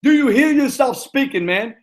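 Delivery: high, loud scolding of a player; retold scolding. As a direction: bark it at him like an angry coach. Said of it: "I said".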